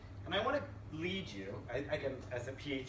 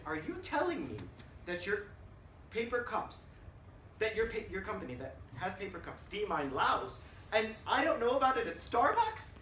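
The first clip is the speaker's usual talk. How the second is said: higher, rising disbelief, louder at the end; incredulous; 6 semitones higher, 3 dB louder than usual. Retold or imitated. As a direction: build to an indignant peak. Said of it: "I said".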